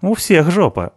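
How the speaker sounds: plain reading voice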